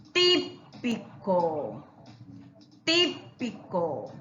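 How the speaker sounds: típico very slow, TÍ set apart; stress on TÍ. Shown as a demonstration. Teacher shows the accented I, típico in slow syllables. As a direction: punch TÍ, then drag out pico. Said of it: "la I tildado".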